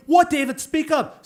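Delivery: loud, high-pitched scolding of a pupil; exasperated nun. Retold or imitated. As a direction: bark it impatiently across a classroom. Nun orders pupil to speak up.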